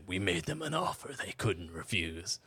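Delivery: lightly voiced manly impression; a hint of a mock mobster line; imitated manly voice. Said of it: "she threw in a manly voice".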